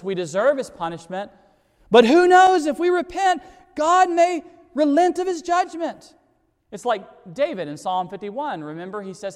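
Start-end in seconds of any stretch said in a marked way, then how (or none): 1.9-6.1 desperate hope. Acted. Preacher voicing Nineveh's desperate hope.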